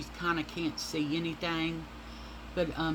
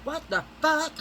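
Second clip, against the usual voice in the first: shocked husband; 10 semitones higher, 3 dB louder than usual. Retold or imitated. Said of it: "He said".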